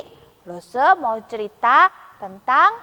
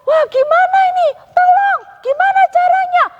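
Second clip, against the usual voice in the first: high, fast, louder cries for help; disciples screaming; 4 dB louder than usual. Acted. Disciples crying for help as boat sinks, fast high panic. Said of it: "mereka teriak-teriak".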